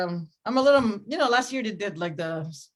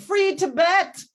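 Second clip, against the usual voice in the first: high, loud, drawn-out battle cry; screamed; 9 semitones higher, 6 dB louder, much slower than usual. Retold or imitated. Wife in bed with her husband, mock triumphant scream. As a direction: holler it like a protest slogan. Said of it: "and I screamed".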